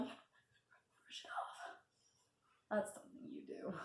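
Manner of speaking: breathy whisper, very slow; stunned whispered echo; whispered disbelief. Retold or imitated. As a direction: whisper it back in numb disbelief. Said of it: "I'm like".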